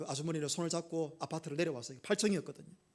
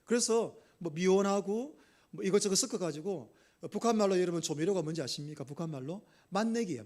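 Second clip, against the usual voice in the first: ordinary talk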